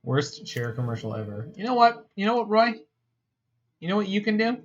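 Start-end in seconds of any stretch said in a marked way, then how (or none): 1.6-4.6 mock high scolding voice. Mock lecturing voice to chat.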